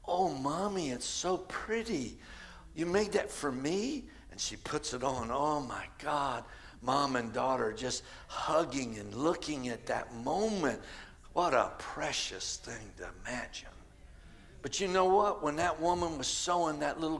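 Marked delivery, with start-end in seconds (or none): 0.1-4.1 put-on little girl. Little girl receiving Mommy's handmade gift, softly delighted. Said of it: "the little girl says". 4.3-13.7 low, soft narration; hushed storytelling. Real, not acted. Preacher recounting mother and healed daughter, hushed.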